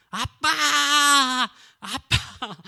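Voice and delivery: high, loud desperate call; boy calling his father across the field; crying out for dad. Retold or imitated. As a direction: shout for your father as the bull drags you. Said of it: "막 부르는 거예요".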